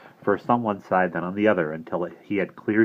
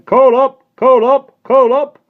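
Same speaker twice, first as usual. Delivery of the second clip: much higher and louder street cry; shouting coal man; 15 dB louder, slower than usual. Acted. Coal man banging on the door, shouting his call. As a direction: bellow it at the front door.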